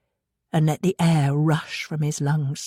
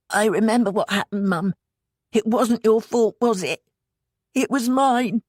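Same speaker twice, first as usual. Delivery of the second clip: put-on slightly higher child voice; lightly voiced small boy; 5 semitones higher than usual. A narrator's reading. Joey confessing to Mum, lightly childlike.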